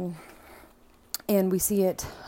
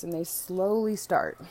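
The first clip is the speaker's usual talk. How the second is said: ordinary talk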